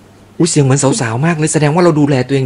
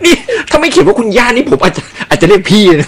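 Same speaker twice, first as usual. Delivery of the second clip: talking through high-pitched laughter; laughing; 12 semitones higher, 3 dB louder than usual. Real, not acted. Host says he'd call grandma big sister, laughing. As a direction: tease while cracking up, voice shooting up.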